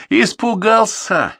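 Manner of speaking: slow, high, mocking smirk. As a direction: sneer the word out at him. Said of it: "ухмыльнулся Борзенко".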